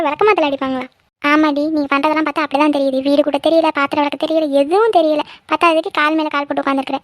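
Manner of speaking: usual speaking voice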